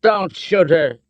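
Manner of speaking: high, urgent yell. A narrator's reading. Son protects Elsa from colonel, yelling. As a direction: yell to stop him. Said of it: "Andy yelled".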